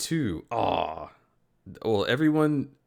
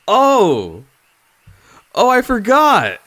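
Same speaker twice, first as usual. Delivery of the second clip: surprised exclamation; 12 semitones higher, 12 dB louder, slower than usual. Real, not acted. Sudden realization while laughing.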